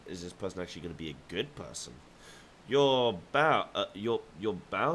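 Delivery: normal talking voice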